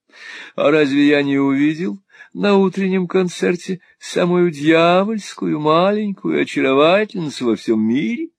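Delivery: high, drawling adoration; foppish flattering husband. A narrator's reading. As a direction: gush sweetly, stretching the compliments.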